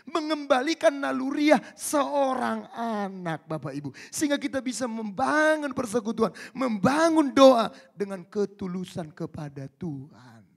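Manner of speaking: loud, high, slow preaching; fiery preaching. Acted. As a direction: proclaim it with full force. Preaching climax. (berkhotbah lantang dan perlahan)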